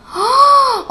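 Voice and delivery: sharp high startled gasp; sudden gasp. Acted. Hare waking to remember the race, sharp gasp. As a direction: gasp as it hits you.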